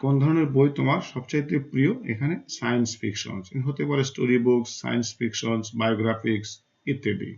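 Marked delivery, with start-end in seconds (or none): none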